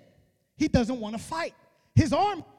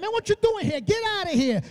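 much higher, indignant mock scolding; retold angry yelling; 7 semitones higher, faster than usual. Brother orders David off the battlefield, mock angry yelling. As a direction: act out a brother chasing someone away. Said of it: "he starts yelling at him".

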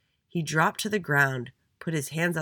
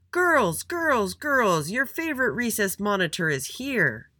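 high, loud showy greeting; calling out; 8 semitones higher, 5 dB louder, slower than usual. A narrator's reading. Little Andy greets the girls on the playground, calling out. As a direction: announce yourself grandly, pitched up. Monitor announces himself to girls.

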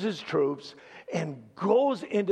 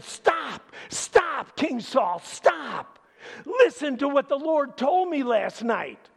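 much higher and louder, urgent cry of stop; shaking, shouting rebuke; 9 semitones higher, 5 dB louder than usual. Retold or imitated. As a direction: prophet trembling as he shouts at the king. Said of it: "he started shaking".

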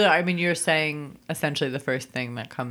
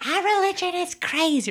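mock announcement; 14 semitones higher than usual. Imagined believer announcing.

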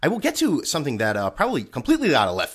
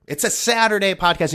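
normal talking voice

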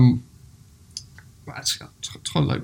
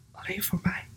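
soft, secretive story whisper; 4 semitones higher, 10 dB quieter than usual. Acted. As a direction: whisper it as the amazed bear. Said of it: "fluisterde hij".

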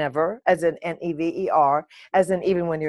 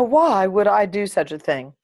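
normal talking voice

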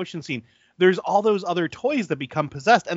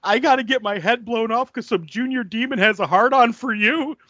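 imitating panicky Chicken Boy; 7 semitones higher than usual. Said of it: "He goes".

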